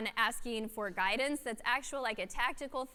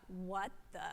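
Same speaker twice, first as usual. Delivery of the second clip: stunned what the; 4 semitones lower, 9 dB quieter than usual. Retold or imitated. Stunned reaction to the email.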